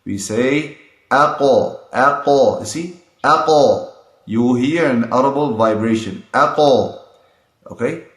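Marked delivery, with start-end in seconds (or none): 0.0-6.2 aqo with an audible echo on the q; qalqalah bounce. Shown as a demonstration. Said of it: "make vibration in the pronunciation".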